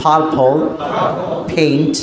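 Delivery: slow, word by word with pauses; slow demonstration. Shown as a demonstration. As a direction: model each word separately for learners to repeat. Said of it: "আমার সাথে প্রথম স্লোভাবে বলেন". (ধীরে ধীরে, থেমে থেমে উচ্চারণ)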